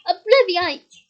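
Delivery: loud yelled incantation; yelled spell. A narrator's reading. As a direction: bellow the spell out.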